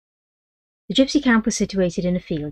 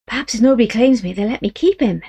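put-on hopeful boy; 5 semitones higher, 5 dB louder than usual. Boy voiced.

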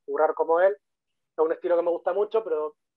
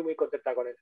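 ordinary delivery

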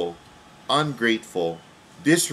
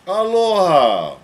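drawn-out loud slow greeting, high; preacher calls aloha to the camera, loud and slow; big slow aloha; 7 semitones higher, 8 dB louder, slower than usual. Acted. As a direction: boom the aloha out slowly to the viewers. Televangelist greets his viewers.

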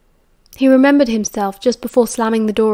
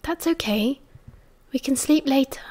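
hushed, tender, much softer voice; soft reassurance; 3 semitones higher, 7 dB quieter than usual. Acted. Sirius tugs Remus towards him, soft reassurance. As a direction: murmur it close, putting him at ease.

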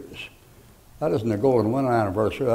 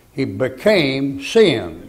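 1 slow emphatic; 3 semitones higher, 4 dB louder, much slower than usual. Real, not acted. Preaching emphasis.

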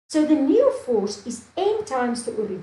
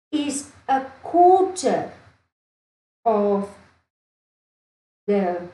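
slow emphatic dictation; 2 semitones lower, slower than usual. Real, not acted. Teacher spells out key conclusion.

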